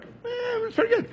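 high, dismissive shrug of a reply; offhand shrug. Retold or imitated. Young believer to Martha, high offhand shrug. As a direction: brush it off lightly, voice jumping up.

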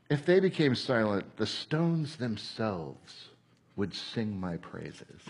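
low, hushed and slow; Jesus answering. Retold or imitated. Preacher voicing Jesus answering the Pharisees, low, soft and slow. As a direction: let it sink to a quiet, weighty reply. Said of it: "And Jesus says".

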